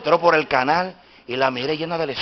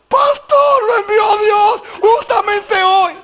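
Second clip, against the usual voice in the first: very high, shouted mock-tearful cry; imitating overjoyed woman; 7 dB louder, much slower than usual. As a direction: shriek it as the delighted mother greeting the pastor. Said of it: "Contenta, me dijo". (imitando gritos de alegría)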